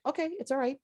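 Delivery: high, soft reassurance to a scared dog; gently calm an anxious pet; soothing. Retold or imitated.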